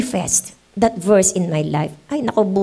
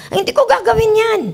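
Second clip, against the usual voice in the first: mock indignant refusal; 11 semitones higher, 3 dB louder than usual. Acting the faithful believer's refusal.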